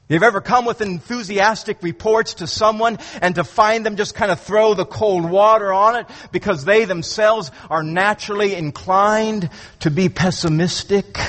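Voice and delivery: usual speaking voice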